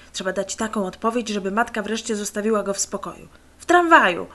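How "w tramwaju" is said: loud, curt, high snap; sharp loud impatient answer; rude retort. A narrator's reading. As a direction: snap it back rudely to get rid of her. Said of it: "odparł niezbyt grzecznie".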